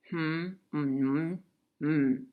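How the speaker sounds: closed-mouth hum, like speaking underwater. Shown as a demonstration. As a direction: hum the words with lips sealed. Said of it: "it does sound like you're speaking underwater".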